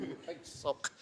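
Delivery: hushed jibe under the breath; muttered insult. Retold or imitated. Co-teacher calling her colleague a sook, muttered. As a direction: drop suddenly to a whispery mutter.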